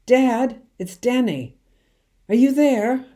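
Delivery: high, loud shouts into the night; calling out. A narrator's reading. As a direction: call out as loud as you can for Dad. Boy calling for father in woods.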